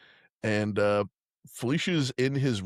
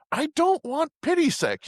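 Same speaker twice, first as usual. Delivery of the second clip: high, slow, whiny protest; hammed-up hurt pride; mock indignant refusal; slower than usual. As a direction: draw out a wounded refusal way up high. Acted refusal in a quarrel.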